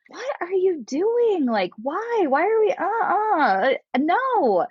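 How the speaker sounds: high, drawn-out whiny protest; irritable. Retold or imitated. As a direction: whine it out in exaggerated disbelief. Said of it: "I'm just kind of irritable".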